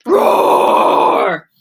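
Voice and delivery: loud breathy roar; bear roar. Acted. Bruce losing his cool at the guests, loud roar. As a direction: let out a big angry roar. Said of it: "lose his cool".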